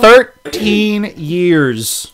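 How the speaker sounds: slow, stretched emphasis on thirteen; dragged-out dismay. Pretend. Host stressing the lost book, very slow emphasis. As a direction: draw out the years in disbelief.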